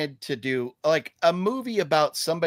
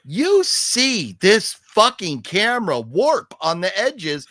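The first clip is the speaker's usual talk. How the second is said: higher and louder, fed-up complaint; irritated raised voice; annoyed rant; 6 semitones higher, 5 dB louder than usual. Real, not acted. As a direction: vent about the warped camera. Angry rant about camera.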